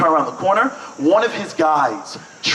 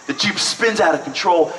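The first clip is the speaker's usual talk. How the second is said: ordinary talk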